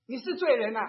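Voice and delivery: imitated loud accusation; accusing. Acts out a passerby's accusation.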